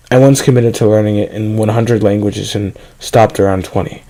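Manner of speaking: ordinary delivery, nothing marked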